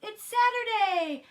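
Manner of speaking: high, loud cheer in Froggy's voice; excited yell. A narrator's reading. Froggy waking up on Saturday, excited yell. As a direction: whoop it out high and loud, stretched. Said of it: "Froggy woke up and yelled".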